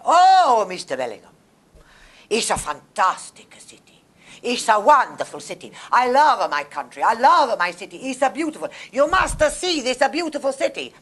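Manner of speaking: put-on loud, high, gushing Italian accent; over-the-top Italian enthusiasm. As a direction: gush like an Italian son-in-law charming the father. Voicing Italian husband to her dad.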